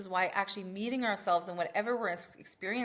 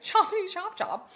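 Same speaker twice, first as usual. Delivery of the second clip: laughing chop chop; 12 semitones higher, 6 dB louder than usual. Pretend. Urging hurry, laughing.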